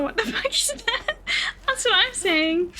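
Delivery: high, laugh broken into the question; laughing disbelief. Real, not acted. Streamer reacting to a disturbing search, laughing in disbelief. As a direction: crack up midway through asking. Reacting to what was looked up.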